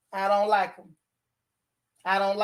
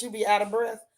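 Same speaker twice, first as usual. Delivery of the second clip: ordinary delivery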